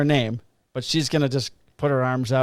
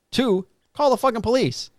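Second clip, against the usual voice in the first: much higher, punch "fucking police"; emphatic; 10 semitones higher than usual. Real, not acted. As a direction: drive the obvious point home. Listing what a parent should do.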